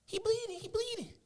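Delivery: high mock panicked sisters; sisters screaming. Sisters on the bed watching the beating, high mock panic. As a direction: squeal it like frightened little girls. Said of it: "sitting there screaming on the bed".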